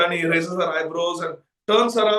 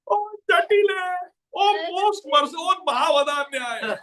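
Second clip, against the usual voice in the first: hammed-up crying appeal; mock wailing; 8 semitones higher than usual. Kalavali begs at Jatila's lotus feet, mock wailing. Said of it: "starts wailing and crying profusely".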